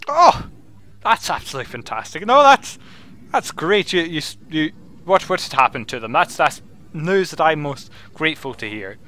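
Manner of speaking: excited wizard, voice leaping up; delighted wizard. Acted. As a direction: react as a wizard thrilled by good news. Wizard hears good news.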